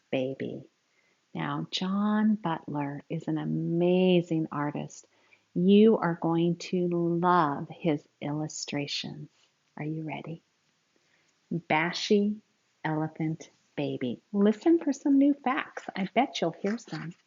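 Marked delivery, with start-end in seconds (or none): none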